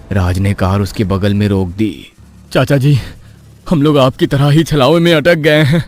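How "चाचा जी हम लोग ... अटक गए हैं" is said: earnest appeal, rising higher at the end; pleading. Acted. Stranded husband begs villager for help, pleading. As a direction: beg an elder for help. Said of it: "राज के विनती करने के बाद".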